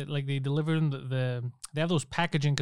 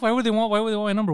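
mildly indignant, higher and fast; incredulous retort; 6 semitones higher, 4 dB louder, faster than usual. Retold or imitated. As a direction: rush out a baffled question. Retells indignant reply to agent.

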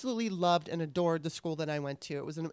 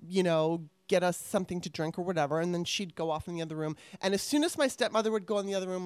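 ordinary delivery

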